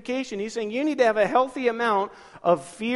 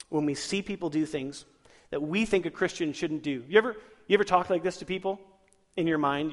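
ordinary talk, nothing marked

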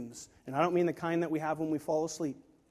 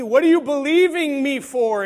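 loud, higher booming question; voice of God; 7 semitones higher, 12 dB louder than usual. Retold or imitated. God asking the preacher a question, loud voice of God. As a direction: demand it at full volume. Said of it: "when God asked me".

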